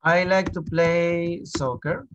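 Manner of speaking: slow, clear teacher dictation; slow model sentence. Shown as a demonstration. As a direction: spell it out slowly for the class. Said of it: "teacher models sentence slowly". (dictado lento de maestro)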